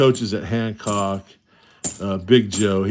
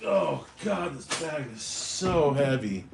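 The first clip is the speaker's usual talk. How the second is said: higher, softer effortful groan; straining; 4 semitones higher, 7 dB quieter than usual. Real, not acted. Lugging a heavy bag of coins, straining. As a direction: talk while heaving a heavy load.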